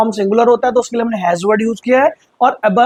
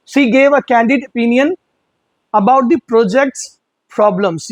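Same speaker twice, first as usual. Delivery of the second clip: slow example read; much slower than usual. Shown as a demonstration. Teacher dictates the candid example, slow and clear. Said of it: "example sentence read".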